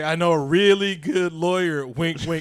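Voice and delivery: loud, sly knowing voice; Rosario Dawson. Retold or imitated. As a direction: play it with an over-the-top hint. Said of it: "Rosario Dawson say".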